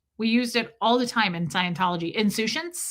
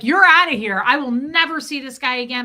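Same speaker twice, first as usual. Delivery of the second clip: loud, raised indignant dismissal; retold pissed-off outburst; 3 semitones higher, 7 dB louder than usual. Client retelling dismissal of overcharging vet, pissed-off outburst. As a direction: retell firing someone, loud and fed up. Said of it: "I was so pissed".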